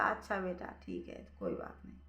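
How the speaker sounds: a mother hiding her tears from her son; tearful mother. Acted. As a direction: swallow the hurt and let it go quietly. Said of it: "माँ के आँखों में आंसू आ गए".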